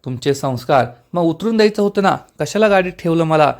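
ordinary delivery

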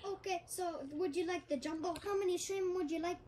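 restaurant order-taker with a put-on Chinese accent. Restaurant worker answering a customer, in a Chinese accent. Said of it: "the guy who's taking the order in the restaurant".

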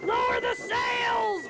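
high, slow, loud command; captain's shout. Acted. Captain ordering the crew in the storm, shouted command. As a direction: bellow it over the storm. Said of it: "Braidbeard shouted".

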